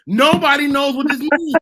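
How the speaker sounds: high, loud exclamation; high-pitched, emphatic outburst; loud insistence. Real, not acted. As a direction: raise your voice to insist. Exasperated host insisting nobody knows.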